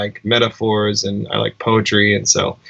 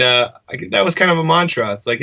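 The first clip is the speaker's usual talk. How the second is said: ordinary talk, nothing marked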